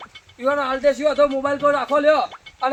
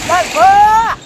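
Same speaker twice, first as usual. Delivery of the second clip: loud call across the farm, pitched up; shout the name; 6 semitones higher, 11 dB louder, much slower than usual. Acted. Told to shout, he calls the farm owner by name. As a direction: yell his name to call him out. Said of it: "બૂમ પાડજે, તું બૂમ પાડ ને બોલાવજે".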